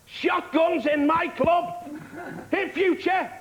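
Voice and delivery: high, slow mock outrage over the mic; outraged club owner. Owner on the mic scolding the club, high mock outrage. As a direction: bellow like a tiny boxer owner at the crowd.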